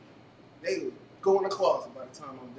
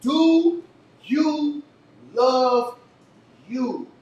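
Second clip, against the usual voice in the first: each word split by pauses, loud and higher; 7 semitones higher, 12 dB louder, much slower than usual. Real, not acted. Host drilling the question into the crowd, word by word. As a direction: hit every word on its own.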